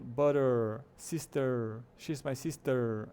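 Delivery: BUTTER and SISTER ending in a long "errr"; r-colored schwa. Shown as a demonstration. Teacher giving examples to learners, prolonged final R. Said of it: "é um R mais prolongado".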